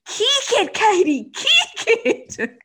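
high mock Australian shout with laughter; playful high-pitched yell, Australian accent; imitated shout. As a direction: holler it like an Aussie auntie, half laughing. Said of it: "used to always shout".

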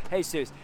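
"Jesus" said the Spanish way, "HAY-soos"; "Jesus" with an h sound and oo vowel, "hay-SOOS"; Spanish pronunciation. Retold or imitated. Preacher showing how the Spanish man says the name, "Jesus" as "hay-soos". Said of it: "he said it over and over".

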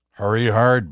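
hollered curling call; curling skip yelling. Pretend.

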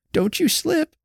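put-on high, worried old woman's warning; tremulous old woman. A narrator's reading. Old woman warns Jenny on the icy steps, high and tremulous. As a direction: quaver it out like a fretful old lady. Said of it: "said the old woman tremulously".

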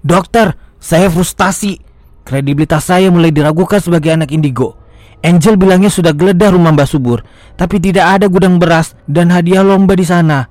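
high, loud, desperate complaint; anxious boy begging the doctor for answers; frustrated pleading. Acted. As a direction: pour it all out to the doctor, voice climbing. Egi pours out frustration to doctor.

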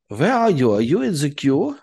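lightly put-on German accent, eager; Sebastian's German accent. Friend texting from the queue, mock German accent. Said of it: "not as inappropriate as his German accent".

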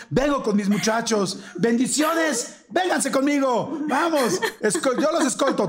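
hammy call with blessings to the crowd; hammed-up shout. Husband rushing the sample, shouting to the policeman, hammed-up. Telling policeman he escorts sperm.

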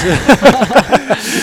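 loud, high cackling laugh; big burst of laughter. Real, not acted. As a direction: crack up at a friend. Laughing at friend's excitement.

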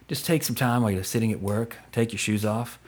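low, soft, seductive ad read; sultry perfume ad. Pretend. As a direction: purr it like a perfume commercial. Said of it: "listening to my sultry voice".

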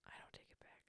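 whispered under her breath; sly little whisper. Real, not acted. Whispered cheeky retraction.

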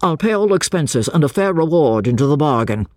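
high start, settling as instructions go on; urgent pursuer. A narrator's reading. Pursuer offering a policeman a reward, urgent instructions.

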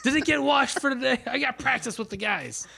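put-on high-pitched kid voice, laughing; a kid yelling across the house to mom; whiny teen asking mom.

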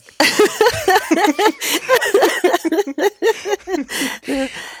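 high, helpless laughter; long laughing fit. Real, not acted. Guest losing it while explaining the book, high laughing fit. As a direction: crack up for several seconds. Guest breaks up mid-sentence.

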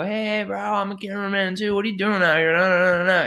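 put-on high, slurred drunken babble. Drunk guy pestering a man with a camera, slurred. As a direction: play yourself hammered, words running together. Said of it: "I'm drunk".

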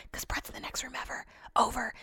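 real whisper so he can't hear. Real, not acted. To listeners, hiding the Peloton plan from Brett, in a real whisper. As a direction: hush it so Brett next door won't hear. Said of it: "I don't wanna say that too loud".

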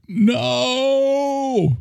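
high anguished cry of no; Charlton Heston despair. Retold or imitated. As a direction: howl it like a ruined hero. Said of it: "And he's like".

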